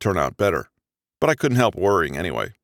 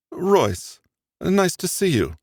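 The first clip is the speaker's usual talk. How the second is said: cool, polite greeting with a higher voice; chilly tone; 10 semitones higher, slower than usual. Acted. Margot greets her ex coldly, chilly politeness. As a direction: greet him coldly, no warmth. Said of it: "Her chilly tone said otherwise".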